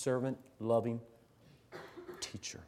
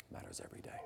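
hushed echo; 17 dB quieter, much faster than usual. Real, not acted. Teacher to staff, hushed echo of the saying. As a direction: repeat it under your breath, unvoiced.

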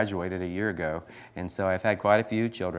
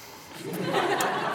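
burst of laughter. Real, not acted. Laughs at wife's remark.

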